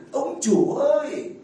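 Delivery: slow, drawn-out mocking "Ông chủ ơi"; mock formal call. A priest mocking a wife who calls her husband master. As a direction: drag it out to ridicule the stiff title.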